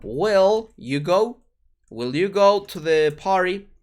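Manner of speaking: slow, clear English example; slow model question. Shown as a demonstration. Teacher modeling a question to Spanish learners, slow and clear. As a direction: spell it out slowly for the students.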